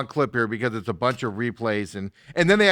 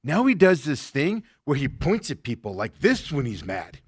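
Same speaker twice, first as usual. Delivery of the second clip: ordinary talk, nothing marked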